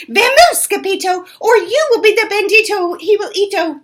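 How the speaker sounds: high, loud panicked call; high loud mock panic; cried warning. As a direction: shout it as you run away. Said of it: "they cried".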